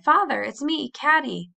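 high, loud, eager cry; cried out. A narrator's reading. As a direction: call out to be recognized. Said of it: "she cried".

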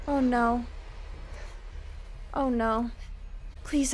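ordinary delivery

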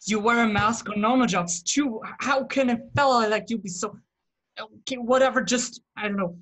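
a boss running out of patience; exasperated. Acted. Boss scolding his timid henchman over the mask, exasperated.

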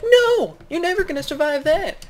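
very high, loud cartoon panic; frantic high-pitched pleading, played for laughs; mock panicked warning. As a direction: cry out in hammed-up alarm. Friend warns in improvised story.

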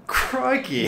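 high, loud Aussie exclamation; broad, playful Australian; mock Australian accent. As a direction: yelp it in a put-on Australian accent. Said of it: "Why did you turn Australian?".

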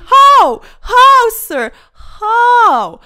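high, loud disbelieving cry; exasperated shouting. Real, not acted. Player shouting at opponents who play better, exasperated. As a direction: demand an answer from the heavens.

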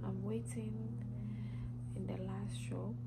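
a soft whisper so the shop won't hear; hushed whisper. Real, not acted. Vlogger in a shop talking to viewers, hushed whisper. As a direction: whisper quickly to the camera, keeping it down.

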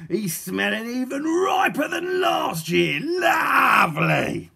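loud, high, drawn-out gloating; relishing villain. A narrator's reading. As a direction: build to a huge stretched shout of delight. Villain relishes the terrible smell.

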